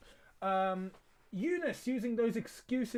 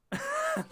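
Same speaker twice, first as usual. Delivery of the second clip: short high burst of laughter. Real, not acted. Streamer laughs at the goalmouth carnage, high laugh. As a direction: cracks up.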